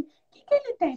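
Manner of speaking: high-pitched retold question; lightly acted high inquiring voice; curious questioner. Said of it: "chegou e falou assim".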